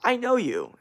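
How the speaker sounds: lightly imitating the girl who recognized him; mild girl voice; girl's voice. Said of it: "she just turns around and goes".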